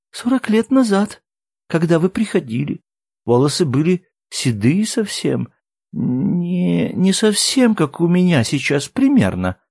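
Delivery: high, fragile recollection with a touch of tears; tearful. A narrator's reading. Woman recalls the men forty years ago, mildly tearful. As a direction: recall it haltingly, close to crying. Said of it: "Она всхлипнула".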